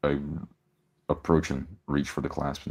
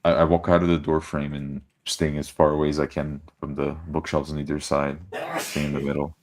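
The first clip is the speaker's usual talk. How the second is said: normal talking voice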